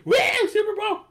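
high, loud "Woo, Super Bowl!"; mock cheer. Host joins the Super Bowl hype with a whoop. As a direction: whoop like a crowd.

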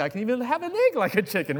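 high, pleased chicken voice; chicken character. Acted. Chicken showing off its egg, high voice. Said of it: "they'll say".

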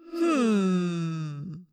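low, soft satisfied hum; villainous hum. A narrator's reading. Visser Three savouring his capture, low soft hum. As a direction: purr it with menace. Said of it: "Visser Three said".

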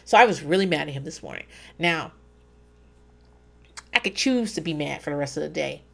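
usual speaking voice

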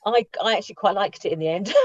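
ordinary talk, nothing marked

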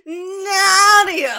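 high cried-out shout of a name; dramatic anime scream; anguished cry. Retold or imitated. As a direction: wail the name at full voice. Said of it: "He's like".

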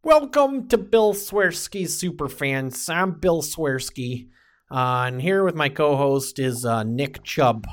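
put-on slow Chicago Superfan accent; drawn-out Chicago sports-fan accent. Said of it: "should we do the entire analysis in, uh, the Chicago accent?".

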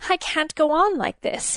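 high outburst after a pause; bursting out. A narrator's reading. King bursting out to Elena, high. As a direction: it finally breaks out of him. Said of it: "he finally burst out".

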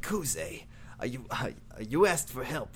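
grumpy boss Dojima. Retold or imitated. Dojima scolding Kuze, low and soft. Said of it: "He's like".

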